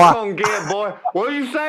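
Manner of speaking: loud, very high hillbilly holler. Pretend. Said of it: "It's redneck".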